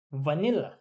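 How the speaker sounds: ordinary talk, nothing marked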